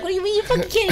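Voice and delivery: high, incredulous outraged squeal; indignant outrage. Retold or imitated. Dangling kid to his brother, high disbelieving outrage.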